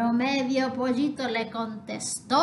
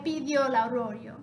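ordinary delivery

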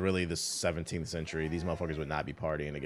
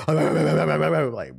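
laughing out the word like; 8 semitones higher, 12 dB louder than usual. Real, not acted. Laughing at crew bark slider.

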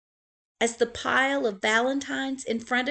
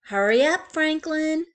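mother calling him, louder; 8 semitones higher, 8 dB louder than usual. Acted. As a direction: hurry him along like a mom. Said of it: "said his mother".

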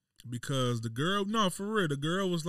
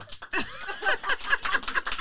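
high burst of laughter. Real, not acted. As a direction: crack up laughing. Big laugh at own rant.

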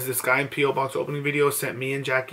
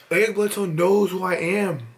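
excited; 4 semitones higher, 5 dB louder than usual. Real, not acted. Fan stunned by star's reply.

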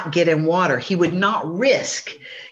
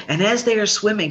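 ordinary delivery, nothing marked

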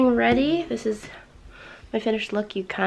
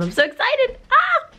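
excited; 4 dB louder than usual. Real, not acted. Excited squeal.

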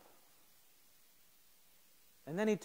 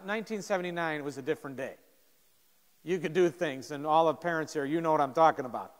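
ordinary delivery

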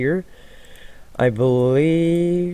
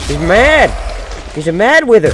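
higher and louder, thrilled alarm; excited shout; 7 semitones higher, 6 dB louder than usual. Real, not acted. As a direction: announce it like big news. Excited about angry boss.

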